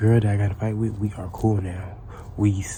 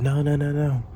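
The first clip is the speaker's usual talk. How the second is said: higher-pitched run of imitated protests; alarmed staff; 7 semitones higher than usual. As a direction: mimic flustered adults trying to stop a fight. Voices staff trying to stop fight.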